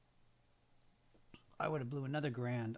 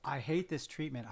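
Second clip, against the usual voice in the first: usual speaking voice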